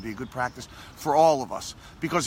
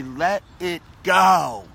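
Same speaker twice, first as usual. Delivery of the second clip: slow, drawn-out and louder, letting it all go; slow release; 7 dB louder, much slower than usual. Shown as a demonstration. Guiding viewers to release old pain, slow and drawn out. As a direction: model the phrase slowly for the viewers. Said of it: "I want you to just say".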